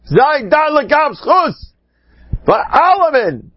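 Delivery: high-pitched, impassioned quoted exhortation; with feeling. Retold or imitated. Preacher quoting a plea to judge everyone favourably, with feeling. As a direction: cry it out high, drawing out the last words. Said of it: "mit Gefühl!".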